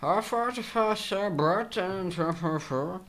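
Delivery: high, garbled Irish gibberish; that guy's Irish accent. Acted. Doing that guy's accent for a friend, garbled Irish. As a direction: mangle it into high-pitched Irish babble. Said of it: "I wanna do that guy's accent, though".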